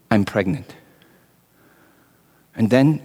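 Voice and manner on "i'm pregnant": hushed and much softer, barely voiced; soft trembling admission; quiet confession. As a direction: let it fall out softly, afraid to say it. Mary confesses, voiced by Joseph.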